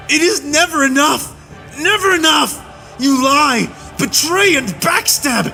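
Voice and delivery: high, relentless angry shouting; raging tirade. A narrator's reading. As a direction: hammer every accusation home.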